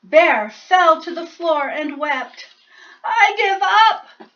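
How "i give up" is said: slow, high loud wail; blubbering. Acted. Bear collapsing in tears before the mouse, blubbering. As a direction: weep the surrender. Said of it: "he blubbered".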